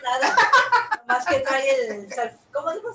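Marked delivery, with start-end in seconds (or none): 0.4-1.0 bright laugh. Real, not acted. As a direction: burst of laughter. Host laughs on air.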